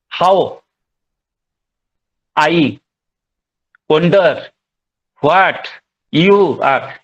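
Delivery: long pauses after HOW, I, wonder and what; division between feet. Shown as a demonstration. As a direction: say "How... I... wonder... what... you are" in separated pieces. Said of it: "a division between feet".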